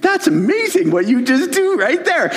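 high-pitched marvelling; retold amazement. Reacting to a colleague's sudden tone change, amazed. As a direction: re-enact being amazed, pitch way up.

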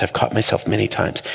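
usual speaking voice

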